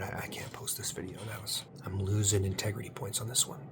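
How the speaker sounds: ordinary talk, nothing marked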